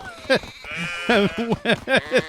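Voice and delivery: a high, breathy burst of laughter; high giggle. Real, not acted. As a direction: let a giggle escape. Laughs at the excited sheep.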